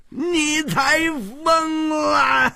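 very high, slow wailing roar. Acted. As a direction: stretch it into a furious high howl. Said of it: "秋瓷王怒吼道". (拖长高音怒吼)

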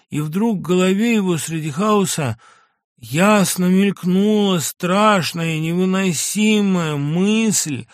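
a lightly heightened narrator voice; intense story narration. A narrator's reading. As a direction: build the horror of realization. Narration of painful revelation.

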